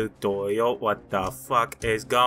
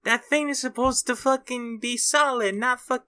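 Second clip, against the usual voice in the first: frustrated rant; 11 semitones higher than usual. Real, not acted. Still raging about wall.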